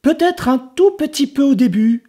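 put-on high, smiling child voice; smiling boy. Enzo reassuring the ogre, high and smiling. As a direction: say it with a sly smile. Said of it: "sourit Enzo".

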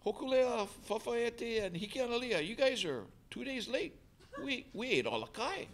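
high-pitched imitation of an island premier; Henry Puna. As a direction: put on the premier's high voice, addressing the late crews. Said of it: "so Henry Puna, the premier".

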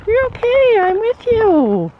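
high, slow reassuring coo to a horse; calm the nervous horse, high and gentle; soothing pet talk. Real, not acted.

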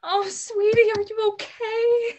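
high, loud, frantic cry; frantic worry. Acted. Mother rushing to her hurt daughter, frantic and high. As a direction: rush to her, terrified she's hurt.

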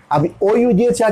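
ordinary talk, nothing marked

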